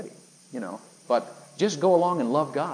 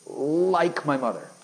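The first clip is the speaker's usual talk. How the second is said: slow, higher, laboured confession; struggling to say it; 4 semitones higher, 3 dB louder, much slower than usual. Retold or imitated. Man taking minutes to admit feelings for mother, struggling. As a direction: drag each word out reluctantly. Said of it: "They were very hard to come out".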